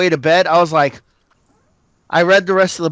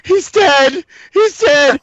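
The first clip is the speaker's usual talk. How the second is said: high, wailing mock-sob; sobbing wife; 9 semitones higher, 4 dB louder, much slower than usual. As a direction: wail it like a weeping wife. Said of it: "And she's like".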